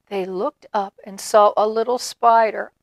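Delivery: ordinary delivery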